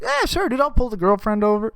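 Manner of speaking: high, airy mock-dopey agreement; mock coworker agreeing. Imitating the coworker shrugging off the warning, high and mock dopey. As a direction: play him as an oblivious bro. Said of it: "He's like".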